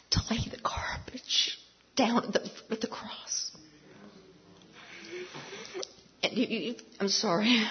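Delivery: soft, breaking off as emotion takes over; real choked-up emotion. Real, not acted. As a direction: voice failing into a halting whisper. Overcome, breaks off and apologizes.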